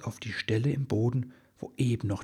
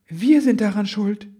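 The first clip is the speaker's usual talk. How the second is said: alarmed confession; 11 semitones higher, 10 dB louder than usual. A narrator's reading. Guilty realization, urgent.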